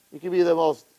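ordinary talk, nothing marked

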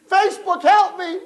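high desperate scream; screaming for help. Acted. King in the boiling pot screaming for help. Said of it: "he started to scream the name".

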